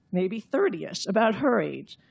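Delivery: ordinary delivery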